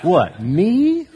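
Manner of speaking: high, loud laughing "what, me?"; hammed-up innocent sibling; mock innocence. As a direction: feign total innocence with a laugh.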